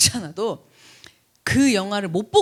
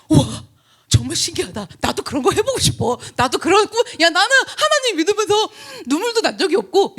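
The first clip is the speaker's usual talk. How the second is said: excited friend; 9 semitones higher, 4 dB louder than usual. Retold or imitated. Excited friend voiced in retold story.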